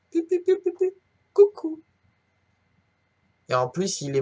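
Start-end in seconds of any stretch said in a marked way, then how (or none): 0.1-1.8 very high, squeaky and small; tiny squeaky voice. Acted. Feather floating, tiny high voice.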